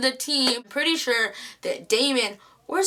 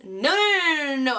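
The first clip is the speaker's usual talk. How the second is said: panicked no; 4 semitones higher than usual. Retold or imitated. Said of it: "I started freaking the F out".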